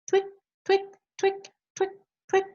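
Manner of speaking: very high, clicking toenail sounds; high twick clicks. Retold or imitated. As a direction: click out each twick, tiny and sharp. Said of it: "They go".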